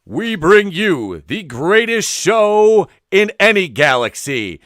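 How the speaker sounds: booming, slow ring announcer hype. Shown as a demonstration. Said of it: "introduce our show ... ring style".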